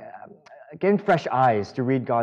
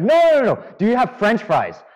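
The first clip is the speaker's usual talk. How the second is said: much higher, louder exasperated insisting; frustrated customer; 8 semitones higher, 4 dB louder than usual. Retold or imitated. Drive-through customer insisting to the teen cashier, exasperated and loud. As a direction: repeat it like he can't believe you don't get it. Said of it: "he's getting so frustrated".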